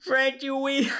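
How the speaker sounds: high-pitched echo in a cartoon New York voice; Joey Wheeler impression. Co-host echoing the joke, Joey Wheeler impression. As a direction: copy the New York anime-dub voice. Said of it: "We definitely did Joey Wheeler".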